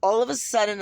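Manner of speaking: ordinary talk, nothing marked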